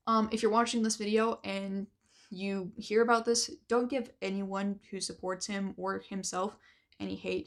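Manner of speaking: ordinary talk, nothing marked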